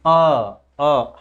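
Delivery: अ as a drawn open schwa. Shown as a demonstration. Teacher showing what replaces silent r, अ as a schwa. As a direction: hold a plain अ in place of the r. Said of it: "उसके जगह पे क्या पढ़ते हैं".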